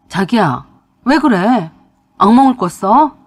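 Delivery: husband shaking wife out of a nightmare; alarmed husband. Acted. Husband wakes screaming wife. (악몽 꾸는 아내를 다급히 깨우는 남편)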